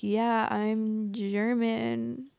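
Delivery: very slow, drawn-out brag; milk every word, playfully; mock boasting. Vlogger milking her German heritage, drawn-out mock brag.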